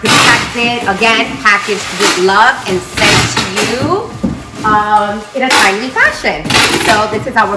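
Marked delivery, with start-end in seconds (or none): none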